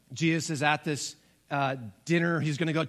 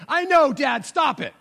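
exasperated daughter; 9 semitones higher, 7 dB louder than usual. Retold or imitated. Daughter annoyed at teasing.

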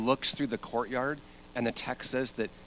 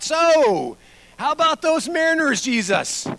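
awkward Peter small talk; 11 dB louder than usual. Acted. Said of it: "sat there and thought".

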